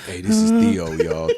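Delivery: smooth radio host intro. Acted. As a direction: play the slick late-night DJ. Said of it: "that was sexy as shit".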